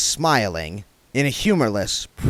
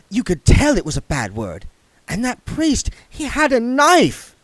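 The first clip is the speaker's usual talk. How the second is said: put-on prim young lady voice; 11 semitones higher, 5 dB louder than usual. A narrator's reading. Saccharissa voiced.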